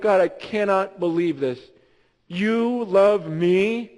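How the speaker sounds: slow, reverent wonder; a believer marveling to God; awed prayer. Pretend. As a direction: linger on each word in awe.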